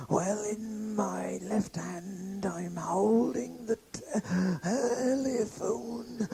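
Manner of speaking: slow whispered creepy caller; breathy whisper caller. Retold or imitated. Obscene caller whispering down the phone.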